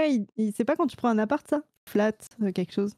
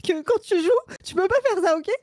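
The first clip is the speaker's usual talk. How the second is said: mock exasperated scolding; 6 semitones higher than usual. Mock quarrel, scolding back.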